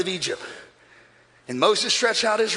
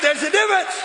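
shouted; 7 semitones higher than usual. Shown as a demonstration. Preacher showing the crowd how to shout it. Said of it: "You gotta shout it like I'm preaching it".

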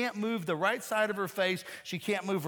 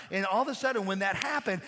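ordinary talk, nothing marked

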